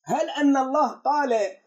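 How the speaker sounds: ordinary talk, nothing marked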